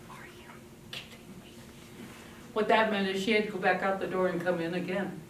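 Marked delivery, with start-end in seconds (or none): 0.1-1.5 whispered exasperation; muttered teen protest. Retold or imitated. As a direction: breathe it out in disbelief. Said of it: "she'd go".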